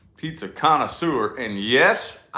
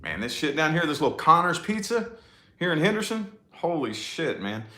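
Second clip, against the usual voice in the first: ordinary talk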